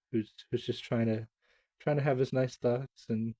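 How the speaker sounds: ordinary delivery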